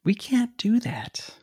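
whispered. Acted. Hushed refusal.

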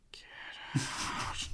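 eerie unvoiced whisper; ghostly whisper. Seance, ghost voice answer.

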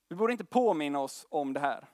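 usual speaking voice

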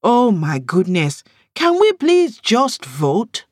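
fed-up friend; exasperated. Acted. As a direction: beg them to get on with it. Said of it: "Yemisi threw her head back".

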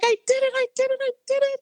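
very high, loud, gleeful squeal of victory; giddy excited squeal. Retold or imitated. Retelling her first gig to a friend, a high excited squeal. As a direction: squeal it like you just won. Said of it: "I was, like, bouncing off the walls".

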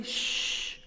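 long unvoiced shush; imitated hushing. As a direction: hush someone with a drawn-out shh. Imitates son hushing him.

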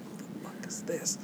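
whispered, drawn-out disbelief; retold secret remark, barely voiced; covert aside. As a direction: whisper it slowly under your breath. Retold covert remark about stranger.

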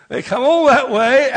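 chuckling through the words; real laughter through the story; laughing while telling. Real, not acted. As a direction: let the laugh carry the lines.

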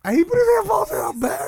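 high, loud playful hype; mock excited announcement. Teasing the guest putting his headphones back on, mock excited.

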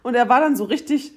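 ordinary talk, nothing marked

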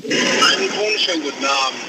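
distant hands-free car phone; calling over a noisy truck speaker; on speakerphone. Real, not acted. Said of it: "Freisprechanlage furchtbar ist". (über Freisprechanlage)

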